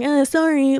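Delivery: high, half-hearted little sorry; mock offhand apology. Imagining shrugging off a one-off fling, mock offhand apology. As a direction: toss off an insincere apology.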